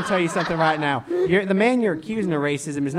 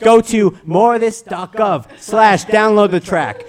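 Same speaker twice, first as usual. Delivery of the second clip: promo plug; 5 dB louder than usual. Acted.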